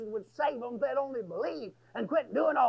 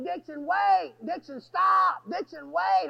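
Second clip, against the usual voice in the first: high, loud re-enacted shouts; yelling; 6 semitones higher, 5 dB louder, slower than usual. Preacher chasing Dixon across the prison yard, yelling. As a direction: yell urgently after a runaway. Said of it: "I started yelling".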